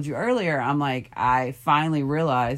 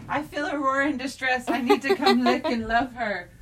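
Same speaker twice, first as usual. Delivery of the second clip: high, sweet dog voice; the dog, high and eager to comfort; puppy voice; 10 semitones higher than usual. Pretend. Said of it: "She's like".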